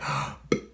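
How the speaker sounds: quick acted intake of breath; sharp gasp. Dreamer about to say the name, sudden gasp. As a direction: gasp as if starting the word. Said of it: "And I said".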